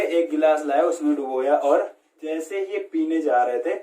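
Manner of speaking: ordinary talk, nothing marked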